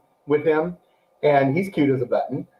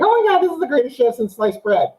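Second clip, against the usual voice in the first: gushing reaction; 8 semitones higher than usual. Retold or imitated. Acting out own excited reaction.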